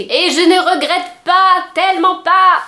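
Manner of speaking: drawn-out emphatic praise; emphatic enthusiasm. Real, not acted. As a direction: really lean into the delight. Emphatic enthusiasm about the book.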